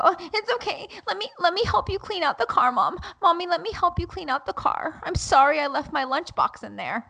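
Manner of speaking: put-on high, thin little-boy voice; imitating young son. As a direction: voice a contrite child eager to make up. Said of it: "and then he'll go".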